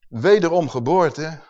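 normal talking voice

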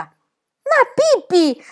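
very high, drawn-out scolding cry; teacher losing patience, shrieking the name slowly; exasperated shout. Acted. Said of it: "gridò la maestra spazientita".

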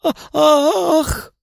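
sudden high loud cry. A narrator's reading. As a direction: let out a sharp scream of release. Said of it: "громко прокричала девушка".

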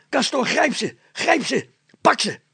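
high, loud roar at the dog. A narrator's reading. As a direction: yell to set the dog loose. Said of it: "brulde Meiling".

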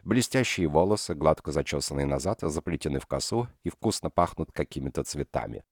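ordinary delivery

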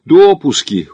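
high, loud, playful smile; smiling reply. A narrator's reading. Смит answering his partner's list, smiling. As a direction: toss it back with a smile. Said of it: "улыбнулся в ответ Смит".

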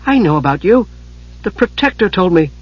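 put-on high-pitched young woman's amazement; wondering. A narrator's reading. Usha recognises the old wizard, wondering. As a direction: build upward in astonished recognition. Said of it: "Usha stared at him in wonder".

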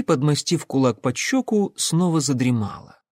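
plain reading voice

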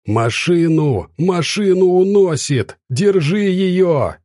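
high, drawn-out cry of alarm; voice-straining shout. A narrator's reading. Bystander sees the car swept away, straining shout. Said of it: "кто-то закричал совсем рядом, срывая голос".

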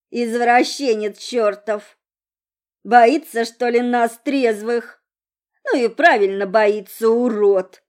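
put-on loud slurred drunken rant; drunk woman voice. A narrator's reading. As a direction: rail at him, sloppy drunk. Drunk girl ranting.

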